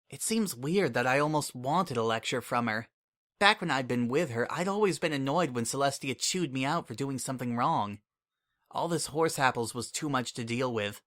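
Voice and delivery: plain reading voice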